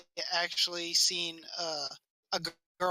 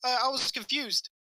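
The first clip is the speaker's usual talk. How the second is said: raised, mildly panicked retelling; freaking out; 5 semitones higher than usual. Retold or imitated. First grader excuses himself to the librarian, mildly freaking out. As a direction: play a flustered child making excuses. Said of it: "I started freaking out".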